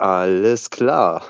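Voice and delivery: drawn-out, slow "sure, whatever"; sarcastic reaction. Retold or imitated. Viewer reacting to spouses who believe nothing, drawn-out sarcasm. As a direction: stretch it out slowly in mock agreement.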